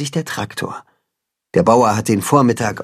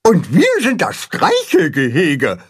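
calling out; 6 semitones higher than usual. Acted. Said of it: "rufen Pünktchen".

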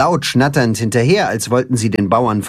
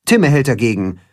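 ordinary delivery

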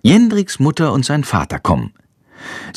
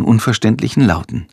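ordinary delivery

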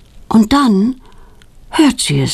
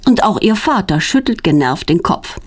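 ordinary delivery

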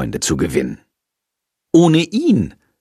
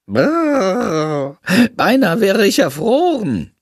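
hammed-up shivering, higher; exaggerating; 8 semitones higher, slower than usual. A narrator's reading. Bat to the children, mock freezing complaint. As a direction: overplay the cold for sympathy. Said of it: "übertreibt Shandor".

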